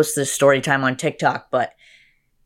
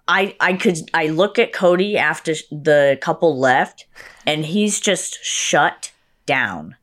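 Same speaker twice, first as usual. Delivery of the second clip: ordinary delivery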